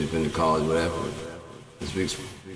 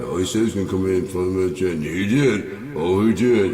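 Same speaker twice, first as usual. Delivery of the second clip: mocking dad; 4 dB louder than usual. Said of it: "my dad was like".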